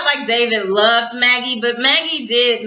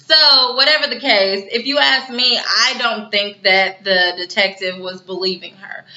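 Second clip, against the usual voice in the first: usual speaking voice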